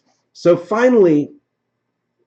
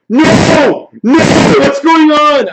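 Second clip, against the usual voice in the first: loud, high cries of disbelief; anguished retold shouting; dismayed yell; 8 semitones higher, 7 dB louder, slower than usual. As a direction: re-enact panicked protest at full voice. Retold dismay at stream failing.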